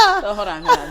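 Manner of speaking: loud laughing hold on. Real, not acted. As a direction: laugh while stopping. Laughing, louder.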